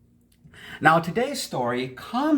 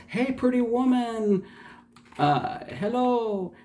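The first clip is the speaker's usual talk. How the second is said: mock yell; 14 semitones higher than usual. Rich merchant yells to a pretty young woman, mock yell. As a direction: call out high, without real shouting. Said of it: "And he yells".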